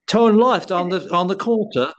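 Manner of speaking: hammed-up posh British navigation voice; bad British accent impression. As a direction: play a stiff British GPS announcer, badly on purpose. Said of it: "it's your bad, you know, impersonation".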